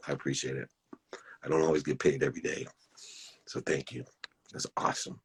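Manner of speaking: real emotion pulling the voice down soft and thin; fighting back tears while thanking; choked up. Real, not acted. Host thanking his guest Chip, choked up and soft. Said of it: "I'm getting choked up".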